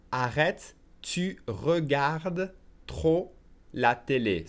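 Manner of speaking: very slow French, pausing between chunks; slow repeat. Shown as a demonstration. Teacher says it again for learners, slowly. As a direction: stretch out "tu regardes trop", every syllable clear. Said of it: "I'll say it slowly again".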